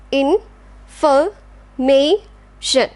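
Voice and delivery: In-for-ma-tion, very slow separate beats; syllable by syllable. Shown as a demonstration. Teacher slowly splitting information for class. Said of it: "Last one".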